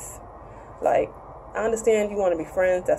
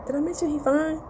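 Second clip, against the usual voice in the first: higher, teasing swoon; gushing; 7 semitones higher, 3 dB quieter than usual. Real, not acted. Vlogger gushing to viewers about his looks, teasing. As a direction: gush about him like a fangirl.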